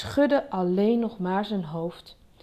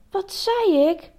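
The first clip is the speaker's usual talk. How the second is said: slow, high scolding question; angry; 11 semitones higher, 4 dB louder, slower than usual. A narrator's reading. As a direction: demand it like a cross parent. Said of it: "vroeg hij boos".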